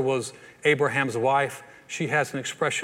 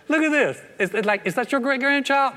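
astonished gawking; 9 semitones higher than usual. Retold or imitated. People gawking at elderly Sarah.

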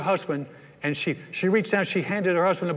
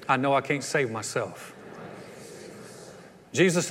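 solemn guided prayer; leading prayer; 3 semitones lower, 5 dB quieter than usual. Real, not acted. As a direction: lead them line by line. Leads sinner's prayer.

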